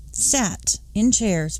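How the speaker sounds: ordinary delivery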